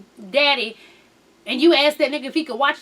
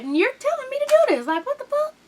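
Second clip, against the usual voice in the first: high-pitched, rushed mock outrage; indignant disbelief; 11 semitones higher, faster than usual. Upset father answering bad custody advice, indignant disbelief. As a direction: climb high in acted disbelief. Voicing Fizz's outraged reply.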